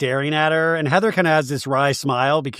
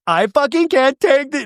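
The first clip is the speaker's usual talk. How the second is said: high, loud, overwhelmed imitation; mock tearful outburst; 10 semitones higher, 5 dB louder than usual. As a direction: wail it out slowly, pretending to break down. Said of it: "this is when Lisa goes to tears".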